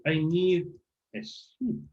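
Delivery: "a ship" with a hushed sh, dropping off; English "ship" sound. Shown as a demonstration. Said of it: "con ese sonido".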